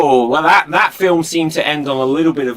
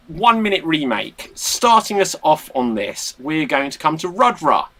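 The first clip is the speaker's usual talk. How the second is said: ordinary delivery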